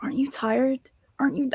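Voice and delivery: high, hushed question; whispered plea. Retold or imitated. Quoting the maid in The Help, whispered and high. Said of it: "She's like".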